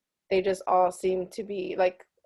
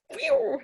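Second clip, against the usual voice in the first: high phew. Real, not acted. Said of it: "I just went like this".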